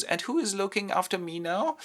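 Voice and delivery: high-pitched plaintive question; fretful princess. Retold or imitated. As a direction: ask helplessly in a high voice. Princess frets after bad news.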